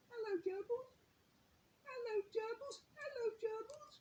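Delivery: put-on very high, cooing little-boy voice; toddler voice. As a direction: coo it like a delighted two-year-old.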